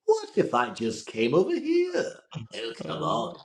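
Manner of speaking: slow raspy villain with British overtones; Mark Hamill's Joker. Shown as a demonstration. Villain toying with Batman, raspy elongated Joker voice. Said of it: "Mark Hamill's Joker".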